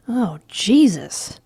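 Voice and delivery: slow, drawn-out, nearly gagging; disgusted. A narrator's reading. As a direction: groan it like you might gag. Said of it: "practically gagging".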